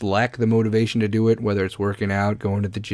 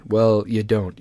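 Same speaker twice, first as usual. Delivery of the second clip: ordinary talk, nothing marked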